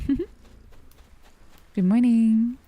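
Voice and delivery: loud, drawn-out cheery greeting; cheerful voice. Shown as a demonstration. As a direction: sing out a cheerful hello, slowly. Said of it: "Use cheerful voice".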